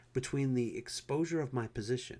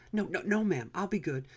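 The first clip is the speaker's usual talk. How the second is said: quick pleading; 8 semitones higher, 6 dB louder than usual. A narrator's reading. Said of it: "I said quickly".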